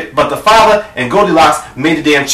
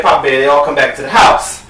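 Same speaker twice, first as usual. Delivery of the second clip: ordinary talk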